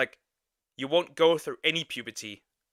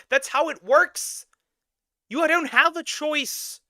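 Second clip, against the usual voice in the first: high, loud outburst of disbelief; outraged yelling; 13 semitones higher, 5 dB louder than usual. Real, not acted. Reactor to the clip's speaker, outraged yelling. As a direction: yell it in incredulous fury.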